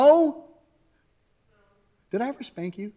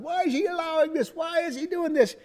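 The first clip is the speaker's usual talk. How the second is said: accusing God; 13 semitones higher than usual. Retold or imitated. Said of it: "people are shaking their fist at God".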